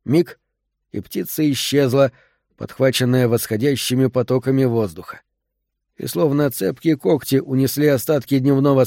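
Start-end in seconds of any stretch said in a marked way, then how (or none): none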